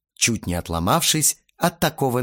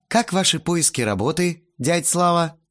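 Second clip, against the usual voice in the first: higher, mock-polite inquiry; oily sweetness; 7 semitones higher than usual. A narrator's reading. Teenager needles the jobless guest, unctuously sweet. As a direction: ask with syrupy false politeness. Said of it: "Елейно улыбнувшись, спросил я".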